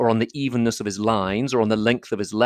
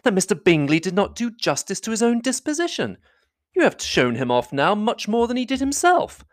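high, animated lady's voice; spirited high-pitched teasing; crying out; 10 semitones higher than usual. A narrator's reading. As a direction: defend him playfully, pitched high. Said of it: "cried Elizabeth".

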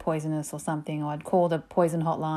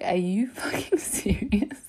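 slow, breath-only mock whisper; imitated speechless outrage; whispered disbelief; 3 semitones higher, slower than usual. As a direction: whisper it as if stunned. Retold disbelief at mum's outburst.

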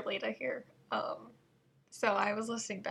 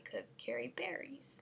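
whispered; 3 semitones higher, 12 dB quieter than usual. Shown as a demonstration. Trailing whisper.

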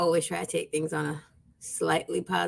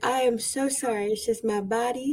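ordinary talk, nothing marked

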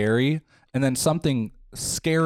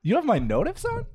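much higher, stunned disbelief; can't believe it, ask it again pitched way up; incredulous repeat; 11 semitones higher than usual. Real, not acted. Teasing a friend who turned on her notifications, high incredulous repeat.

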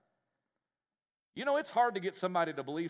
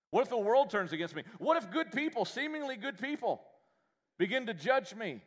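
anxious high what-if; 8 semitones higher, 3 dB louder than usual. Retold or imitated. Voiced anxious what-ifs.

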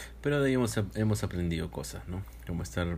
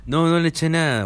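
high loud protest; loud denial; 8 semitones higher, 8 dB louder than usual. Real, not acted. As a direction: protest loudly. Denying the chili.

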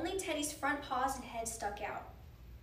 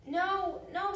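loud, high protest; upset, raised-voice objection; frightened refusal; 7 semitones higher, 5 dB louder than usual. A narrator's reading. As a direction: cry out in refusal. Frightened William refuses the lifeboat.

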